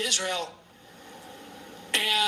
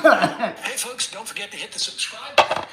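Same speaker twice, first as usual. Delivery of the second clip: fast mock promo read; announcer voice; 6 dB louder than usual. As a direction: rattle it off like an ad.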